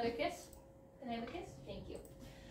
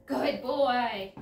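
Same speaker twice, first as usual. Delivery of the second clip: excited praise; 2 semitones higher, 7 dB louder than usual. Real, not acted. Praising dog during tug.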